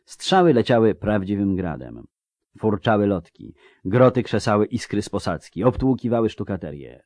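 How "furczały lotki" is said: ordinary delivery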